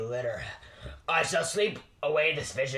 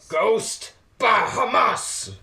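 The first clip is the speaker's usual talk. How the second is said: slow, drawn-out shout, higher and louder; startled outburst; 6 semitones higher, 5 dB louder, much slower than usual. Acted. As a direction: blurt it out in alarm at the ghost. Reacting to ghost.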